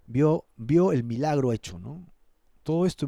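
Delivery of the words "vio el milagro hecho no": ordinary talk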